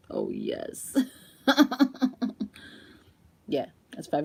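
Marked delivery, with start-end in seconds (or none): 0.1-1.0 blissful sigh. Retold or imitated. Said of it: "I'd be like".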